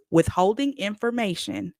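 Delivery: ordinary talk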